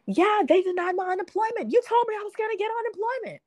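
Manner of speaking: high, fast, upset accusation; frantic friend. Retold or imitated. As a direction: mimic the panicking friend, quick and high. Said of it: "she calls me frantically".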